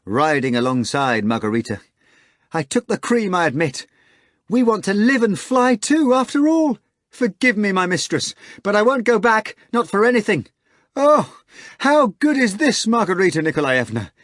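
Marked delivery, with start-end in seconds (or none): 2.5-14.1 high, loud, breathless joy; Natasha, giddy maid. Acted. Maid confessing to her mistress, high and gleeful. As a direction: rattle it off in delight.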